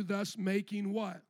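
ordinary delivery

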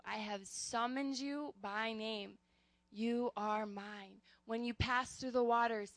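slow, solemn reading with long pauses; scripture reading. Acted. Pastor reads God's promises to listeners, slow and solemn. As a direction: let each promise land slowly. Reads Isaiah passage aloud.

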